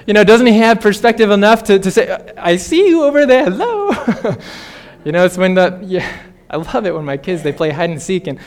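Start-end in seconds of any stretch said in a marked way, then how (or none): 2.4-3.9 much higher, teasing sing-song with a laugh; mock gotcha. God mockingly catching Adam and Eve, teasing high gotcha. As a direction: call out like catching a kid hiding.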